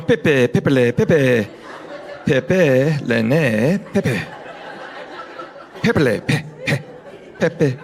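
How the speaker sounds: halting, laughing "pepe, pepele" attempts; mock fumbling over a sign; tourist stumbling. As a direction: stumble through the syllables of "pepele". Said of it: "That woman's going".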